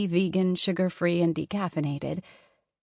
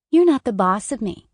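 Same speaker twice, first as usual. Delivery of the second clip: put-on louder, higher childish taunt; 4 semitones higher, 8 dB louder than usual. A narrator's reading. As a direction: defy mom with a jeer. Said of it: "Sophie taunted".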